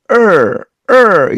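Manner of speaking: er held long with the tongue curled; English r sound. Shown as a demonstration. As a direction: draw er out as a strong American r. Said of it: "이런 발음인데요". (혀를 말아 길게 er)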